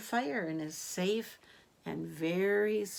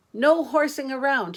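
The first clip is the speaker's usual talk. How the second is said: louder, higher lifeguard call; 5 semitones higher, 8 dB louder than usual. Acted. George the lifeguard calling through a megaphone, loud. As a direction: call it across the pool. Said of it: "called through his megaphone".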